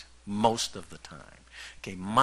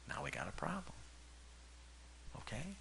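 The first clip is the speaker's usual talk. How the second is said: whispered warning, softer; stage whisper; 6 semitones higher, 17 dB quieter than usual. Real, not acted. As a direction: lean in and whisper the problem. Whispered aside to congregation.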